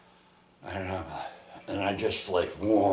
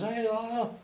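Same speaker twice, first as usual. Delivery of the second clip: Rob's greeting pitched much higher, in a put-on voice; voicing Rob's greeting. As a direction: lightly play Rob calling out hey. Rob greets him back.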